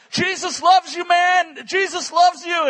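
loud, high holler after someone; calling out. Retold or imitated. Preacher re-enacting calls to the man biking off, hollered. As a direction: shout it down the street to someone leaving.

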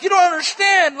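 loud, high-pitched protest; mock exasperation. Husband argues with wife over fish story, mock exasperation. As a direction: push it up high and loud, hammed-up frustration.

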